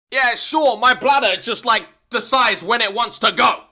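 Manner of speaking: high, loud, indignant yelling; sarcastic loud rant. Acted. Student ranting at the teacher about the bladder, loud and indignant. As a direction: protest loudly that you can't wait.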